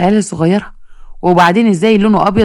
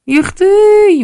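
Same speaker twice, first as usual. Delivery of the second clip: يختي بصوت عالٍ جدًا ومفاجئ; a sudden high cry of shock; shocked gasp; 12 semitones higher, 3 dB louder, much slower than usual. Acted. Pharmacist's shocked exclamation. (صيحة دهشة عالية)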